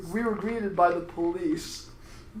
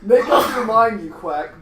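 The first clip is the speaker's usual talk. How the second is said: loud, higher start dropping off; exasperated story-character demand; 4 semitones higher, 9 dB louder than usual. A narrator's reading. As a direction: demand it with a burst of volume. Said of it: "Blaine demanded".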